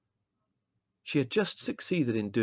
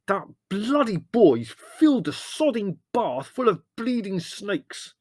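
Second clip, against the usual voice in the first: high, loud, slow raging; outraged, drawn-out bellowing; furious rant; 12 semitones higher, 7 dB louder, slower than usual. A narrator's reading. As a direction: hammer out each curse. Leslie raging about the bath.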